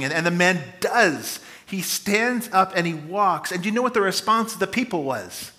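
normal talking voice

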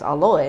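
aloe said ah-lo-eh; three syllables in aloe, open ah first; Korean-style pronunciation. Shown as a demonstration. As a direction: aloe with a sounded eh at the end. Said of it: "we grew up knowing it as".

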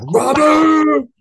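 high, loud mock shout of a name; mock scream. Greeter yelling the name at a customer walking in, loud mock shout. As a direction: bellow the name like a greeter at the door. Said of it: "they're screaming at you like".

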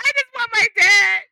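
high, loud, slow sobbing plea; imitating a crying child. Steven crying for his dad, high loud sob. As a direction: wail it like a heartbroken little boy. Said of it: "he starts crying and he just goes".

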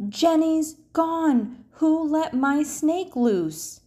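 high, slow, panicked yell; a girl shouting in alarm; Emily shouts. Acted. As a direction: cry out for the lost snake. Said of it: "Emily shouts".

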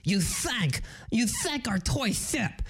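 slushy lisping cartoon duck; cartoon duck impression with a wet lisp; Daffy Duck. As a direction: lisp every s like an indignant duck. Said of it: "you know Daffy Duck?".

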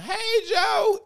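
high, loud, eager hey to an unseen friend; excited call-out. Retold or imitated. Girlfriend calling cheerily to boyfriend's phone friend, high and bright. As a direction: sing out a cheery greeting across the room.

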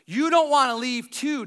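loud hey, guys; Jesus calling out. Acted. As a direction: call them back across the room. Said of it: "And Jesus says".

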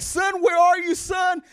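father searching in the rubble, calling out. Acted. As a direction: shout for the lost boy. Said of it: "and he's like".

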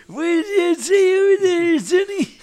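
put-on high, loud old codger; hammed-up elderly man calling over. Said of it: "I very much like my old man voice".

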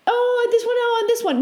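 put-on high, eager little-kid voice; imitated child choosing. Child answering her parent's either-or question, high imitated kid voice. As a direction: imitate a child begging for a pick. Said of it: "And I do that to Aurora".

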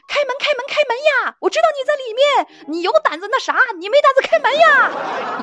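high-pitched acted yelling; 砸门叫喊. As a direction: shout as if pounding on someone's door, demanding they open up. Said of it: "听到有人在砸门啊". (扯着高嗓门模仿砸门叫阵)

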